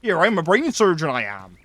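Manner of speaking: a hint of a West Country yokel claiming to be a surgeon; loud West Country boast; West Country accent. Joking that the West Country sounds unclever, light West Country accent. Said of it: "hard to sound intelligent from the West Country".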